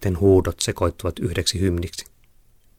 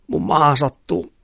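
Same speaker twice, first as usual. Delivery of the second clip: put-on sick girl's complaint; 8 semitones higher than usual.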